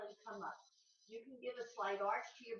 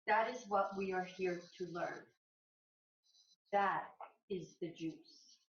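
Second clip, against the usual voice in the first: inspirational reading. Real, not acted. Yoga teacher reads to her class before practice, measured inspirational reading. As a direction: read a passage aloud in weighted, measured phrases.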